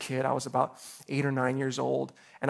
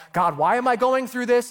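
frustrated plea; 8 semitones higher, 6 dB louder than usual. Retold or imitated. Voicing a frustrated prayer.

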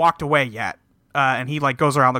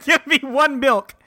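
laughing; 10 semitones higher than usual. Retold or imitated. Joke milk order, laughing.

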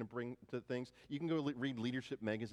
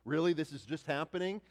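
mock furious; 6 semitones higher, 5 dB louder than usual. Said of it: "these guys are furious".

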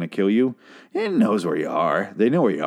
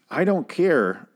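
"I don't care" pitched higher in a strange put-on voice; odd put-on voice; 7 semitones higher, slower than usual. Real, not acted. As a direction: slip into a weird voice on "I don't care". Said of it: "I don't know why I said it like that".

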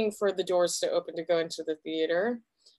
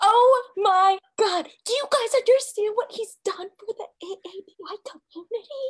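very high, gushing fan outburst; starstruck freak-out; 13 semitones higher than usual. Acted. Fan freaking out over a celebrity walking in, high starstruck gushing. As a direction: freak out in a high-pitched gush. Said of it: "I'm just freaking out".

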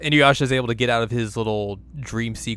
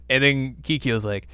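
ordinary talk

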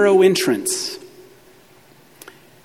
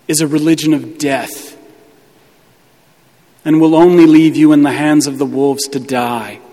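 normal talking voice

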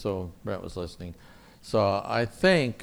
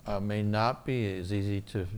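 ordinary talk, nothing marked

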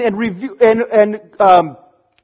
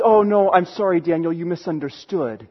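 gentle apology; 7 semitones lower, 5 dB quieter than usual. Acted. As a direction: lower and softer, kindly letting him down. God gently correcting Daniel.

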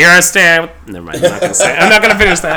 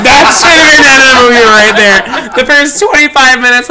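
laughing outburst; 9 semitones higher, 7 dB louder than usual. Real, not acted. Laughing rant about the movie.

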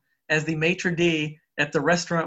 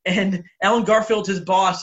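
ordinary talk, nothing marked

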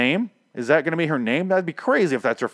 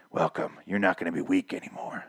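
eerie villain voice; 6 semitones lower, 8 dB quieter than usual. Retold or imitated. Villain welcomes newly turned zombie.

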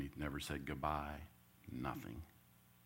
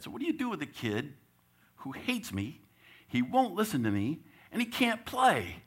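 rising higher to a high incredulous peak; exasperated; 10 semitones higher, 4 dB louder than usual. Acted. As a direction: pile on the problems until it breaks. Coach's exasperated dilemma.

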